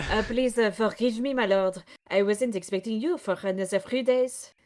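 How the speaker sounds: apologetic put-on French accent; hammy French character, halting. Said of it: "this stupid French voice".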